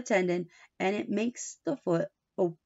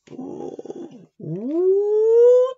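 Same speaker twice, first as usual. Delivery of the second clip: teasing ooh; 11 semitones higher, 7 dB louder than usual. Real, not acted. Reader teasing Tommy's trouble.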